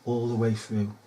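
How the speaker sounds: ALL THE WAY THROUGH low and soft; quiet echo. Acted. Accusing officials of blagging, a hushed echo. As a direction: repeat it quietly, as an afterthought.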